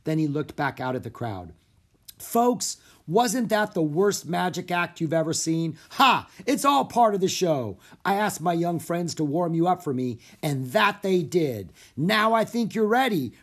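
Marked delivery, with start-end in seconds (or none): 2.2-13.3 high, projected stage patter; showman. A narrator's reading. Harry to the audience after the magic act, showman's patter. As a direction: work the crowd like a showman. Showman addressing the theater crowd.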